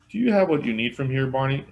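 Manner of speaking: high, loud questioning voice; sheriff asking. Acted. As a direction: call the question across. Sheriff asks deputy.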